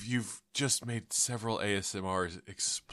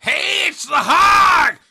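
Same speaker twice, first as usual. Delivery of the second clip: shrieking squealing hog voice, very high and slow; hog shout; 16 dB louder, much slower than usual. Acted. Hog bursting into the podcast, shrieking pig voice. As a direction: scream it like a frantic pig.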